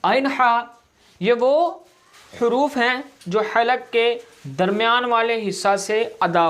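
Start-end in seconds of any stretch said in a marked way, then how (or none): none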